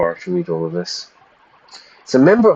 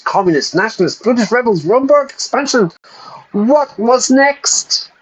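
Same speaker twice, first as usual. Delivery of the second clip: rising alarm; 13 semitones higher than usual. Acted. Dramatic reading of game text.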